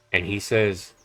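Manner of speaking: normal talking voice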